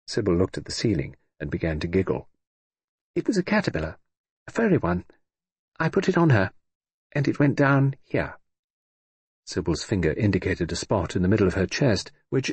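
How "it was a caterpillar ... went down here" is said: put-on higher, giggly child voice confessing mischief; little girl owning up to a caterpillar prank; naughty little girl. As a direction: play a five-year-old proud of a prank.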